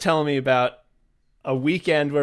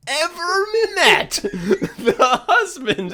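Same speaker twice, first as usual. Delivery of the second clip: high, stretched-out words breaking into laughter; incredulous squeal dissolving into a laugh; 13 semitones higher, much slower than usual. Real, not acted. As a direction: crack up on your own punchline. Cracks up at own punchline.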